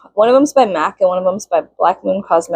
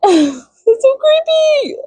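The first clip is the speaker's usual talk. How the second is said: laughing squeal; much slower than usual. Real, not acted.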